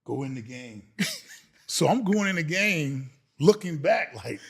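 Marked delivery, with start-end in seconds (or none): 0.0-0.9 low-key, softly spoken order; resigned quiet order. Retold or imitated. Coach with no one else left to send, quiet and resigned. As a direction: imitate a reluctant coach giving in, softly. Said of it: "drops his head and goes".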